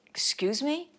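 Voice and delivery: high, affronted question; retold offended disbelief; taken aback. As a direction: bristle at the tone, pitch leaping up. Offended reply to cocky question.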